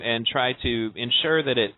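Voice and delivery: ordinary talk, nothing marked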